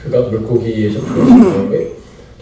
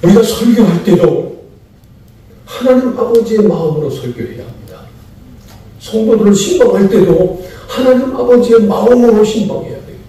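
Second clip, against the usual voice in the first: preaching; 9 semitones higher, 4 dB louder than usual. Real, not acted. Preacher exhorting congregation.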